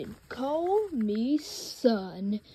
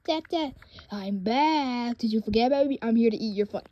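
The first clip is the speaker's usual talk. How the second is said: ordinary talk, nothing marked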